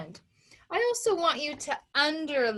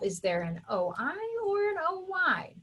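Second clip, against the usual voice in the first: inner thinking voice; 6 semitones higher than usual. Pretend. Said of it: "thinking to yourself".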